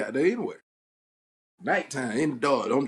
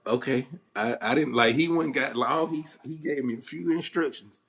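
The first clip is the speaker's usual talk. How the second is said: usual speaking voice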